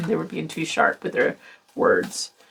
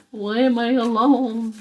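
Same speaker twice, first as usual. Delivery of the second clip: mock whine; 4 semitones higher, slower than usual. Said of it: "And then they're crying".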